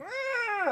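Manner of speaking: very high squeaky mock groan. Mimicking his partner's sleepy protest, high squeaky groan. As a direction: squeak like someone half asleep. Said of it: "you're like".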